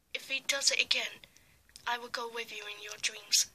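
ordinary delivery

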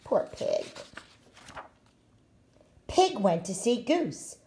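ordinary delivery, nothing marked

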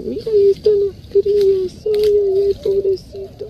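high, loud panicked cries of pity; distressed squeals. Real, not acted. Seeing the baby opossums fall, real panicked high squeals. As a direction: squeal in real alarm at the helpless babies.

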